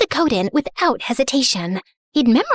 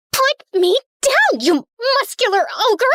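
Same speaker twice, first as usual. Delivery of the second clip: indignant protest; 6 semitones higher than usual. Acted. Heiress slung over his shoulder as he runs, indignant protest.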